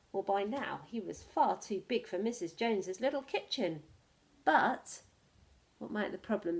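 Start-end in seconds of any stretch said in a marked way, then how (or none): none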